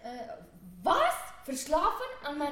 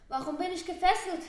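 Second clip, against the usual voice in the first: ordinary talk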